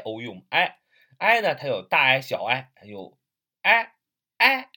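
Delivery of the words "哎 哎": "哎" as a big, open vowel, high and loud; wide-open vowel. Shown as a demonstration. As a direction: demonstrate "哎" with a wide sound, pitched up. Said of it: "那个发音大的".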